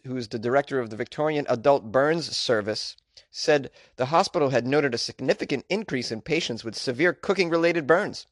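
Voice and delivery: ordinary delivery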